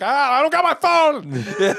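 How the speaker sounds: high, slow yell breaking into laughter; laughing scream. Retold or imitated. Telling her he has no phone, a loud yell breaking into laughs. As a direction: shout it while cracking up. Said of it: "I'm just screaming".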